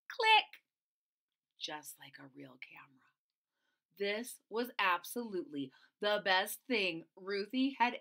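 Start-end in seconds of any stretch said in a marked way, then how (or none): 0.1-0.6 sharp high click noise; camera click sound. Pretend. 1.6-3.1 fast hushed aside; quick secret whisper. Acted.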